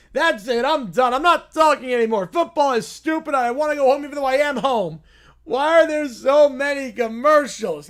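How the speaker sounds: put-on bratty kid throwing a loud fit; mock tantrum. Kid quitting the football stream, hammed-up tantrum. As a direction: stomp and yell like a spoiled child.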